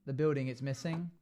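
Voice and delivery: ordinary talk, nothing marked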